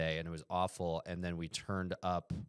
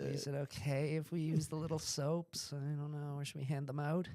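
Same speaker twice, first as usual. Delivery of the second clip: high, tentative little voice; timid tourist; 9 semitones higher than usual. Acted. Guests unsure about using lodge soaps, timid. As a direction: ask meekly in a raised, put-on voice.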